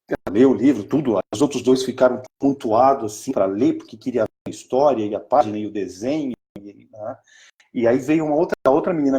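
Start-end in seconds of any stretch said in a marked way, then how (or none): none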